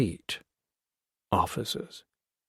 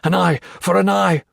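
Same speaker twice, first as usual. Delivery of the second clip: menacing vow; 7 semitones higher, 4 dB louder than usual. Acted. Foster menacing.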